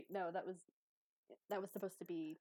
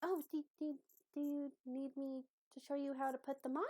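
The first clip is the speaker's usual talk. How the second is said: higher-pitched, tentative character voice; helpful, hesitant Abby; 6 semitones higher than usual. Acted. Abby offers Croak help with the pants, high and hesitant.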